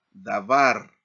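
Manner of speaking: "davar" as da-VAR, loud final syllable; stress on last syllable. Shown as a demonstration. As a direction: hit the VAR of "davar" hard. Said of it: "se enfatiza en la última sílaba". ("davar" con énfasis fuerte en la última sílaba, VAR)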